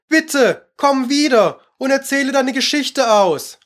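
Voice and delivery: high, pleading call; calling after. A narrator's reading. Alice to the departing mouse, calling after. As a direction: beg someone leaving to come back. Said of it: "rief Alice ihr nach".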